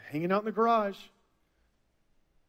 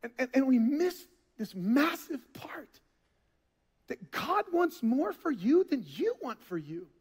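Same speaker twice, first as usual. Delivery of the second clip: hushed; 4 semitones higher than usual. Real, not acted. Hushed point in a sermon.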